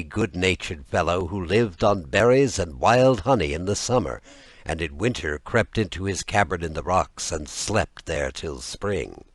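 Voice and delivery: plain reading voice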